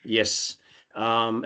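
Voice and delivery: normal talking voice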